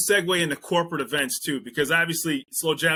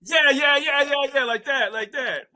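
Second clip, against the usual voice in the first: excited yeahs; 11 semitones higher, 4 dB louder than usual. Real, not acted. Excited agreement with guest.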